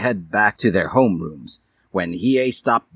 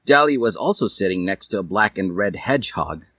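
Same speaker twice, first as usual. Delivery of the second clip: plain reading voice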